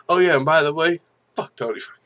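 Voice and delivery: quoting the fighter. Retold or imitated. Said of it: "how did he say it?".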